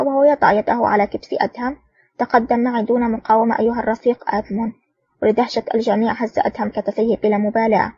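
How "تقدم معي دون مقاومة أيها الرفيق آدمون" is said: ordinary delivery, nothing marked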